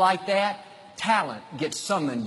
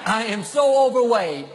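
higher, louder whiny groan; mock complaint; 6 semitones higher, 4 dB louder than usual. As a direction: whine it like a sorry dieter. Mocking a self-complaint.

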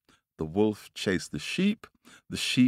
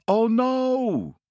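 high, loud wail of dismay; dismayed cry; 5 dB louder than usual. Acted. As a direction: cry out in comic horror. Bird discovers he is in trouble.

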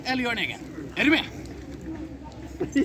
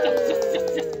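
excited whispered yes; 10 semitones higher than usual. Real, not acted. Celebrating quietly.

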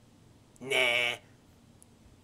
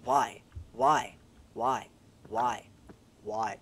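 exasperated why; 8 dB louder, much slower than usual. Real, not acted. Stuck in ice, frustrated.

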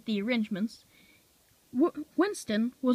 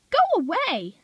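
high, loud squeak; squeaky go away; 9 semitones higher, 5 dB louder, slower than usual. A narrator's reading. Bill squeaking at the rude diesel, high and loud. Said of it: "squeaked Bill".

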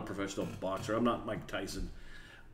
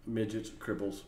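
ordinary talk

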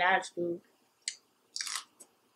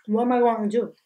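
usual speaking voice

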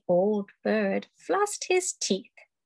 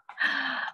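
gasp; 4 dB quieter than usual. Acted. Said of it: "gasp before wow".

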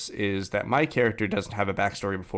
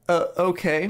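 higher-pitched, hesitant mock compliance; retold unsure reply, pitched up; uncertain agreement; 9 semitones higher than usual. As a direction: act out a puzzled, reluctant okay. Retelling wary reply to game master.

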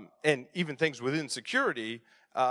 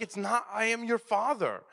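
higher-pitched retold protest; mock defensive protest; 6 semitones higher than usual. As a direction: plead your innocence to your wife, pitched up. Defends himself to his wife.

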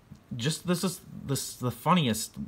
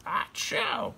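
a breathy, high, hammed-up sneeze; mock sneeze; 11 semitones higher than usual. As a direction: fake a big sneeze for the kids. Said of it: "and he s-, sneezes".

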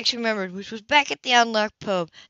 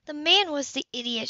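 shot back; 7 semitones higher than usual. Acted. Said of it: "she shot back".

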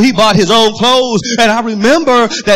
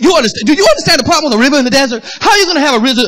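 high, rapid incredulous questioning; agitated preacher getting carried away; worked up; 4 semitones higher, much faster than usual. Real, not acted. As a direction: fire the questions out, pitch raised. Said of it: "I'm gonna calm down".

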